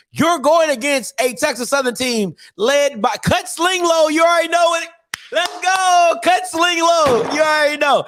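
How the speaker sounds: rallying the PV fans, excited hype voice; hype man. Real, not acted. Hyping the coming rivals.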